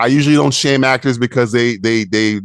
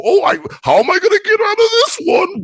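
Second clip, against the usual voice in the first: high squeaky cartoon whine, much higher; mock a hero turned into a clown; goofy comic hero; 4 dB louder than usual. Mocking the show's jokey hero line, high cartoon squeak. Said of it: "I need Andor to go".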